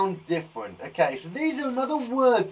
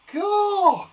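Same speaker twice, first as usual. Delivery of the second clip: mock cool; 6 dB louder than usual. Playing cool for kids.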